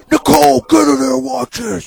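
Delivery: high, very loud summons; calling his wife in to watch a game; yelling for wife. Retold or imitated. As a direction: bellow her into the room. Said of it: "Yell at her to get in here".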